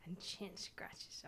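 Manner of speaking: slow whisper to the cat; a slow, hushed murmur to a pet. Real, not acted. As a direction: whisper it while scratching her chin. Murmuring while petting cat.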